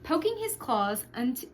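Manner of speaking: ordinary delivery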